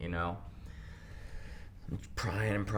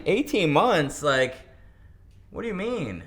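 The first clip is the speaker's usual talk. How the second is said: higher and louder, stunned; shocked disbelief; 9 semitones higher, 7 dB louder than usual. Retold or imitated. Teen hearing program length, shocked disbelief. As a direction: react like you just heard eighteen months.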